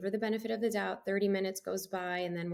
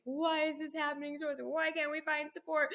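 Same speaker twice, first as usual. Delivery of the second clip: higher, half-tearful plea, lightly acted; imitating crying; 7 semitones higher than usual. To her family, a mild mock-tearful lament. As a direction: retell the meltdown with a touch of tears, pitched up. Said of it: "I start crying".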